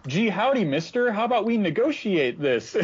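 put-on high, eager gee-whiz boy; chirpy innocent small-town kid; wholesome 1950s kid. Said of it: "Sean is like".

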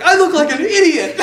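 high, loud quote through laughter; laughing mock dismay. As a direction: imitate mom's dismay while cracking up. Quoting mom, laughing over photos.

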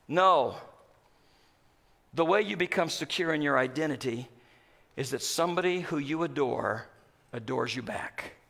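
low, soft preaching; quiet pulpit intimacy; hushed sermon. Acted. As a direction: drop to a hush for the promise.

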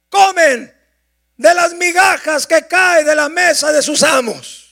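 loud, rising preacher's voice; impassioned pulpit delivery; fervent preaching. Acted. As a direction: drive the sermon home at full force. Preaching at full force.

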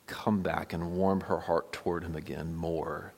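usual speaking voice

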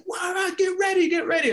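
very high urgent yells; angry coach. Retold or imitated. Coach Bora yelling at the sub to get ready. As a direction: hurry him up in a rage. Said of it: "he's pissed at me".

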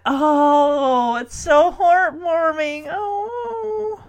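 slow, melting coo rising high; touched cooing. Real, not acted. Host finding the vows heartwarming, touched cooing. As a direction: coo with real tenderness, climbing in pitch.

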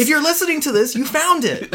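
high, loud mock plug voice; mocking a podcast plug; mock announcer.

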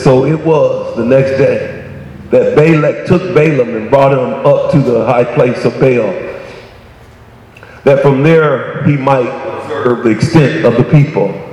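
low, measured scripture reading. A narrator's reading. Preacher reading the Balaam account to congregation, low measured reading. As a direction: read the verse out solemnly, pitched well down.

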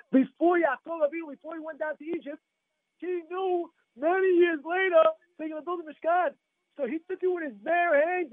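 high-pitched, driving sermon delivery; impassioned preaching. Acted. Rabbi telling congregants of Yaakov's cedar wood, impassioned preaching. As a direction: preach the story with mounting urgency.

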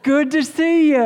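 high, bright put-on church smile; sudden syrupy high-pitched hello after the fight; fake cheerful greeting. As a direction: switch to a sugary high voice for show. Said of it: "you get out of the car and you go".

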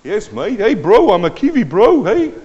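high, loud mock New Zealand accent; Kiwi accent. New citizen showing the congregation he's one of them, playful Kiwi accent. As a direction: greet them loudly as a jokey Kiwi bro. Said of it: "I'm now called a Kiwi".

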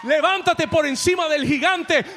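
builds to a loud shout, high and commanding; God's rousing command. Retold or imitated. The Lord commanding the believer to rise, a loud rousing shout. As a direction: call them up over the giant, full voice. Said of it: "El Señor te dice".

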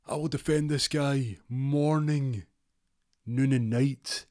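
McNulty vowing to the press, high and grand; defiant defender. Retold or imitated. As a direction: swear it like a soap hero. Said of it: "He goes".

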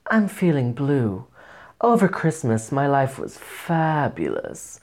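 slow, languid diva voice. Acted. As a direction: put on a drawn-out pop diva, savouring each word. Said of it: "I'll do a [name] impression".

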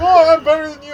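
high, loud bragging; imitated boastful taunt. Wrestler bragging at a fan in a wheelchair, loud taunt. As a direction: lord it over them like a wrestling heel.

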